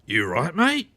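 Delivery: confrontational Australian "You right, mate?", "mate" leaping up; mock challenge after a bump, spoiling for a fight; aggressive. Shown as a demonstration. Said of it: "What the fuck was that?".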